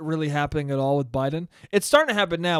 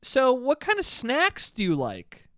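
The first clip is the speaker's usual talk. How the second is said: higher, slow, sweet interviewer voice; higher put-on woman's voice, slow; co-host voice; 7 semitones higher than usual. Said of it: "she goes".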